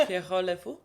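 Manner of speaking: "Pierrot" with throaty R and silent T; French-style "Pierrot", ending on O; French pronunciation. Shown as a demonstration. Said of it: "it sounds French".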